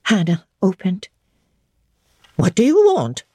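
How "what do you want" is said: a touch of surprise at the door; the servant opening the door at night, surprised. Acted. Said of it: "in a voice of surprise".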